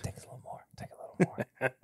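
soft whisper, barely voiced; whispered aside. Real, not acted. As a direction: whisper it under your breath. Muttering while pouring a drink.

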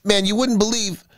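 high, amazed outburst; excited cry. A narrator's reading. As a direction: start telling a friend amazing news. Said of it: "he cried".

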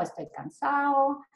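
"cansado" said as "cansao", D swallowed; "cansao" with no D, Madrid speech; dropped D, Madrid style. Shown as a demonstration. Teacher showing how Madrid speakers drop the D: "cansao". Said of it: "se comen la D ahí también".

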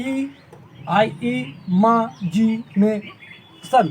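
"ই মা জি নে সন" slowly, each syllable apart; split into syllables. Shown as a demonstration. Teacher repeating for pupils, "ই মা জি নে সন" syllable by syllable. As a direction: pause after each piece of "ই মা জি নে সন". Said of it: "সিলেবেলে ভাগ করে নিতে".

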